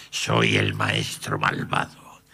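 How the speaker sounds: deep, slow villain menace. Acted. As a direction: growl it like a cartoon master villain. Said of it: "Soy una voz de malvado".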